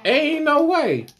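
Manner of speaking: drawn out, high and loud; emphatic disbelief. Real, not acted. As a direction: stretch it out, no way. Echoes with high, slow emphasis.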